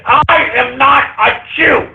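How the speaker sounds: high, indignant insistence; furious denial. Real, not acted. Host insisting to trolls after an insult, furious denial.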